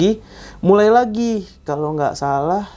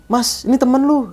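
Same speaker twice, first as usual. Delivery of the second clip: urgent call; 10 semitones higher than usual. Retold or imitated. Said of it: "kata dia gitu kan".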